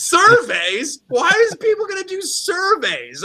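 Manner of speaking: high, slow squeaky mock question; mocking disbelief. Rich people laughing at the surveys, high slow mocking voice. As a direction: sneer at the idea in a high voice. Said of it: "They're like".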